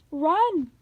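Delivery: loud, high panicked yell. A narrator's reading. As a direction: yell at everyone to flee. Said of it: "Grover yelled".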